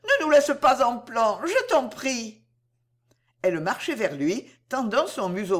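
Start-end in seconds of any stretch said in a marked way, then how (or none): 0.0-2.4 higher, louder plea, slowing at the end; pleading. A narrator's reading. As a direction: beg him not to let them down. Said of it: "dit Bijou suppliante".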